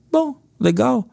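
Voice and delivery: a hint of a bored little kid; small boy shrugging off the question; young son's answer. Pastor voices his young son.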